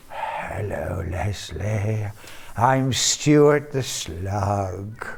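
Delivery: tiny, slow, whispery slug voice; a small slow slug greeting; snail voice. Pretend. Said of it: "in your small voice, snail voice".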